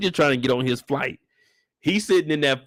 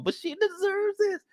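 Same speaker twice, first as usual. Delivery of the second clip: much higher, slow swooning voice; mock gushing girls; 5 dB quieter than usual. Girls filming the proposal, high slow swooning mock. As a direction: coo like starstruck fans.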